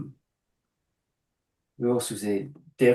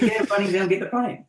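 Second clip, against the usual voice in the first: dismissive retort; 9 semitones higher, 4 dB louder than usual. Retold or imitated. Retold retort to cheap client.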